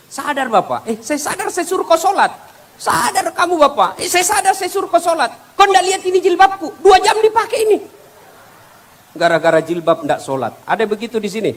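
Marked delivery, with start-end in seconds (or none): 0.1-7.8 high, loud bickering between husband and wife; bickering couple. Acted. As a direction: argue back and forth like a nagging couple. Husband and wife quarrel voiced. (suami istri bertengkar dengan suara tinggi dan keras)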